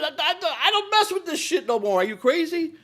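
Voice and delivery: high, disbelieving outburst; you can't believe he asked you that; incredulous refusal. Retold or imitated. Refuses to find crack.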